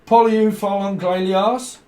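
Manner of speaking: high, loud, slow mock French; botched French accent. Repeating the singer's name, botched French accent. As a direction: draw out a badly faked French name. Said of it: "fucks up the French accent".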